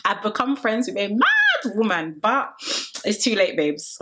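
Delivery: slow, dawning realisation voiced lightly; drawn-out mock horror, lightly voiced; Tony's inner thought. Tony realising her new friend is unhinged, slow mock dismay. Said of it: "she started to deep that".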